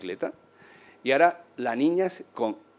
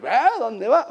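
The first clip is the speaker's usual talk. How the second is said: surprised call; 10 semitones higher, much slower than usual. Retold or imitated. Surprised riders.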